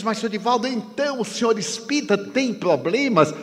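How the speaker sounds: bem mais agudo, pergunta admirada; não acredite no que ouve; incrédulo. Retold or imitated. Estranho incrédulo. (estranho incrédulo pergunta, agudo)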